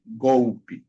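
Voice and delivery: golpe as "GOU-pi"; final E as I. Shown as a demonstration. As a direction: say golpe with L as u, ending on i. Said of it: "Se pronuncia como I".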